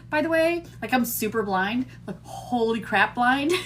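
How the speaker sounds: ordinary talk, nothing marked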